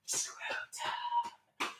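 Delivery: breathy whisper of one word; whispered refrain. Acted. Vlogger's song breaks into a whispered refrain. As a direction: whisper it like a secret.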